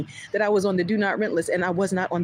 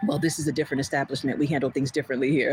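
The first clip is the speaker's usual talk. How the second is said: lower, quick, haughty clerk voice; imitating the clerk's dismissive reply, lower and quick; mock clerk voice; 7 semitones lower, faster than usual. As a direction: lightly put on the manager's smug tone. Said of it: "paying attention".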